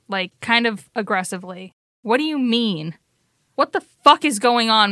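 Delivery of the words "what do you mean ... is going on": raised, exasperated questioning; angry demand. Acted. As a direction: demand an explanation, building louder. Val pushes away, confronts Maureen.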